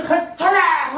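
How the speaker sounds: loud, high preaching shout; rally them with a burning cry; fiery shout. Acted. Acted command to go and burn.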